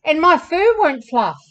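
frustrated camel protesting; bawled complaint. A narrator's reading. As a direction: bawl it out for a children's story. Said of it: "bawled Hannibal".

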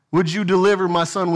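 ordinary talk

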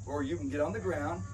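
ordinary talk, nothing marked

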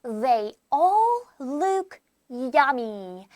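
slow, word-by-word reading; slow model reading. Shown as a demonstration. Teacher shows how the sentence is read, slowly and word by word. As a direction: stretch each word out for learners. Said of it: "이렇게 읽어주면 된답니다".

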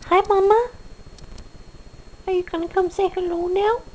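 high, sweet voice for the cat; high pet talk. Real, not acted. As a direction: coo at the kitty, high and bright.

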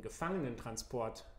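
usual speaking voice

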